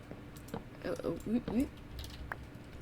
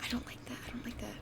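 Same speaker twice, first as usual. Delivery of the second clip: whispering; 4 semitones higher, 10 dB quieter, faster than usual. Real, not acted. Uneasy muttering to herself.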